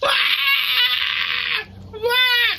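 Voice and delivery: high-pitched imitation baby wail; mock infant "wah"; baby screaming. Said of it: "screaming its head off".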